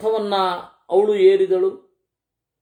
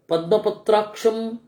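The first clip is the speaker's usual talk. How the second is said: usual speaking voice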